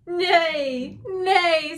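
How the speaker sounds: high, loud horse's "nay"; horse neighing. Acted. Teacher voicing the horse for children, high neighing call. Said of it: "said the horse".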